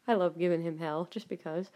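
usual speaking voice

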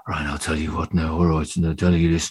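low Brummie accent, imitated; imitation of an actor's deep Brummie patter; Cillian Murphy's Brummie switch. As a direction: slip straight into a low-pitched Brummie voice. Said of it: "And he's just straight, straight into his".

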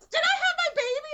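very high, loud disbelieving squeal; astonished new mother. Retold or imitated. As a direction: shriek the question in amazed delight. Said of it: "And she goes".

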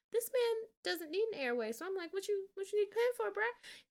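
can't believe what he's seeing, bruh; incredulous. Real, not acted. Host reacting to the doctor's pen, incredulous.

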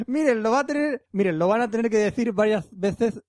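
VECES said with a th for every S; exaggerated Z lisp. Host reading a Mexican listener's email written with Zs, exaggerated lisp. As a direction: overdo the th sound in "varias veces". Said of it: "ha escrito con Z todo".

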